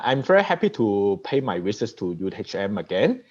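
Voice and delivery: ordinary delivery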